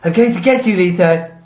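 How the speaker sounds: high, camp cartoon dragon. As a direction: ham it up as a camp villain dragon. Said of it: "Can you do that more?".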